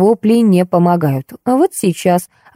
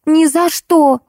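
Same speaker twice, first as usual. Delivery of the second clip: high, wailing never; howling refusal; 11 semitones higher, slower than usual. Acted. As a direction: wail the refusal. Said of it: "взвыла Ольга".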